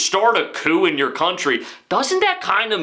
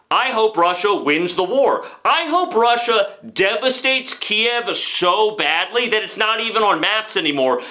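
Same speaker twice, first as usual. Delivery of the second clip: ordinary talk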